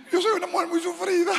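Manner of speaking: high, weepy mock sob; imitating her crying. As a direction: whimper it like the crying sister. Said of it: "Y empieza a llorar". (imitando a la hermana llorando, aguda y llorosa)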